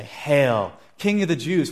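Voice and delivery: loud mock salute, pitch climbing; acted soldiers' jeering acclamation. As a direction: hail him with fake reverence. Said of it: "they mocked him".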